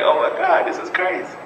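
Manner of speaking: high whimper fading out; mock tearful despair; imitating crying. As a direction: whimper it like a recruit in tears. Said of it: "I start crying".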